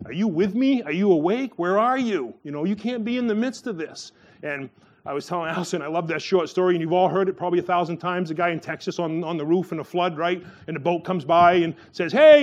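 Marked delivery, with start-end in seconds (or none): 0.1-4.1 higher-pitched, pleading questions; mildly acted protest, pitch swinging up on each question; imploring challenge. Believer asking God where He is, imploring challenge. 12.1-12.4 loud, high hail; calling out. Retold or imitated. Boat rescuer to a man on the roof, calling out.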